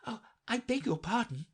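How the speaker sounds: put-on high, humble girl's voice. A narrator's reading. Alice apologising to the mouse, very humble. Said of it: "said Alice very humbly".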